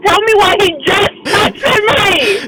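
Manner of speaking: high, drawn-out exasperated cry; outraged outburst. Real, not acted. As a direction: wail it in outrage, slow and high. Outraged at a new text arriving.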